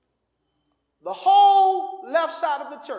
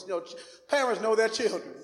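ordinary delivery

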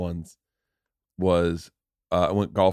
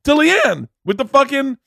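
excited; 6 dB louder than usual. Real, not acted. Excited telling, laughing.

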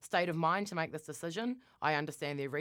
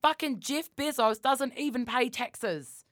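high, indignant rant; worked up; 7 semitones higher, slower than usual. Real, not acted. Friend ranting about billionaires not paying taxes, worked up. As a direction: get heated in slow, emphatic outrage. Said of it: "I'm getting really worked up".

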